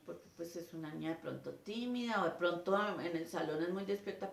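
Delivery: usual speaking voice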